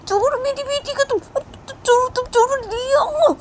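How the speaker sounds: high, loud furious shout; frantic mother yelling, near tears; angry mother. Acted. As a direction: scream at her to let go. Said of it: "बोलती है".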